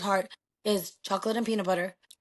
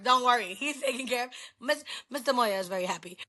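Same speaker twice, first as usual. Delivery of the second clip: ordinary talk, nothing marked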